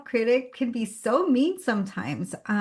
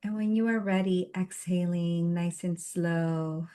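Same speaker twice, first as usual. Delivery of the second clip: guided meditation. Real, not acted. Host grounding a meetup group before the session, guided meditation. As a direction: guide them gently through each breath, unhurried.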